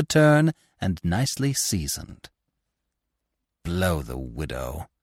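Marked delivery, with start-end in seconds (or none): 3.6-4.9 low, slow growl of disgust. A narrator's reading. Lupin cursing the widow who trapped him, low growl. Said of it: "he growled".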